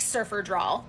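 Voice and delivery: ordinary talk, nothing marked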